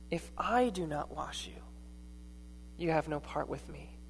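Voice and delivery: quiet repeat. Shown as a demonstration. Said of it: "rests wholly on what Jesus does".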